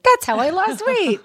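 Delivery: high mock voice of a dieter; mock happy dieter. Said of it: "they're like".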